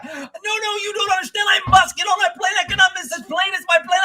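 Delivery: high, loud, frantic imitation; hammed-up panicked yelling; freaking-out traveler. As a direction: act out a man losing it, shouting desperately. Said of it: "he is freaking out".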